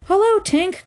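high, bright hail; cried out. A narrator's reading. Wondering boys hail Tinker Bell, crying out. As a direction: call a greeting in a high voice. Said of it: "cried the wondering boys".

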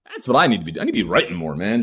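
usual speaking voice

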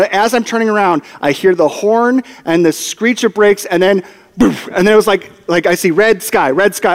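usual speaking voice

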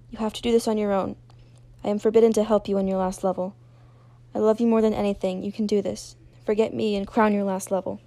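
loud, insistent plea; forceful, earnest urging; urgent encouragement. Acted. Chained friend urges her on.

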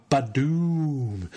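slow, drawn-out explosion sound; comic sound effect. Pretend. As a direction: savour the boom like a comic panel. Reading comic sound effect aloud.

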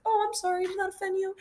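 high, soft, condescending fake sorry; belittling mock apology. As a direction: belittle them with a sugary sorry. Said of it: "in that belittling way".

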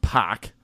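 Pac with no R, for park; dropped R. Shown as a demonstration. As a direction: drop the R so park becomes Pac. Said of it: "is pronounced Pac".

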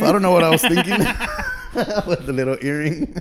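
words broken by real laughter; laughing. Real, not acted. Telling a friend his arrest story, laughing. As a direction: laugh your way through the admission. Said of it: "What is wrong with you".

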